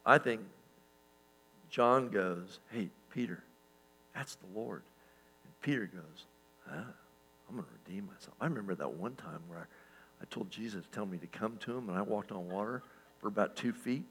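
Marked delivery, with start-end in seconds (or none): 2.7-4.8 soft, understated heads-up to a friend; acted hushed aside. John alerting Peter to the Lord, acted softly. 6.7-14.0 acted self-talk. Peter plotting to redeem himself, quiet acted musing.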